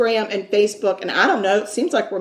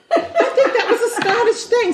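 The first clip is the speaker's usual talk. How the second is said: laughing; 13 semitones higher than usual. Real, not acted. Laughs at the accent attempt.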